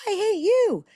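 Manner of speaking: loud, high mock yell; yelling back. Parent yelling back at an angry teen. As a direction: shout it back at the child. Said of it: "you're not gonna yell back at them".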